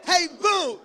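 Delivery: high, loud excited greeting; all caps shout. Pretend. Texting a new crush, shouted in caps. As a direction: yell it like a text in caps.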